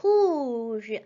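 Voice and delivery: Rouge, calm model for repeating; French rouge. Shown as a demonstration. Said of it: "For red, you say".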